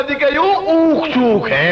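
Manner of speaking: slow, stretched-out verdict; drawn-out punchline. Acted. Old man emerges to prove his point, drawn-out punchline. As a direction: drag it out triumphantly for the laugh.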